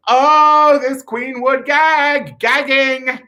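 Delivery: high, loud, drawn-out exclamation; camp gagging. Acted. Queen reacting to opera-length baseball gloves, camp gagging.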